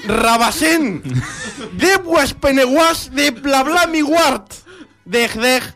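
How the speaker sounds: slow, high Simlish recital; fake foreign language. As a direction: read gibberish poetry grandly. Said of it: "déjame leer poesía".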